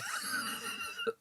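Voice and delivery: sudden high-pitched wheezing laugh; real burst of helpless laughter; high breathless laugh. Real, not acted.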